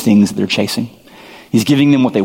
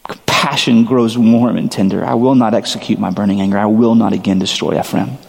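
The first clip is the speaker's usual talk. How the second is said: usual speaking voice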